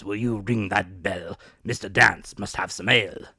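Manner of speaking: lightly voiced bluff squire. A narrator's reading. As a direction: address him with bluff warmth.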